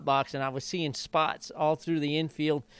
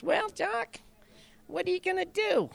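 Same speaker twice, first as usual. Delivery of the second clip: much higher, thin-voiced imitation of the boss; high-pitched chief. Jockey mimics the chief asking about the race, high-pitched imitation. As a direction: squeak it out like the old chief. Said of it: "he had that kinda high-pitched voice".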